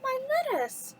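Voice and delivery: high-pitched storybook cry of dismay; acted high exclamation; Belle crying out. A narrator's reading. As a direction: cry it out high, as if just robbed. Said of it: "she cried".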